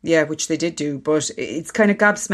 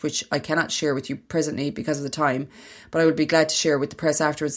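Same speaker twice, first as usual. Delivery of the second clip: reading aloud. Acted. Reads an article aloud.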